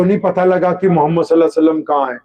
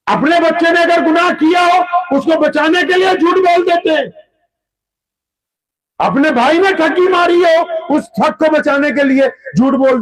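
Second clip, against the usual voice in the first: preaching at full force; 10 semitones higher than usual. Acted. Preacher urging the congregation against lying, impassioned. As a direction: thunder it like a call to faith.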